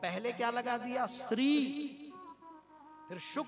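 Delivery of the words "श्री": श्री drawn out high and loud; emphasis on श्री. Shown as a demonstration. Preacher showing the word God put first, श्री held high. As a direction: raise and stretch श्री.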